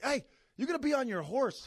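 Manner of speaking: high, loud coach yell. Retold or imitated. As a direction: bark it from the sideline. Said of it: "you'd yell at the forward".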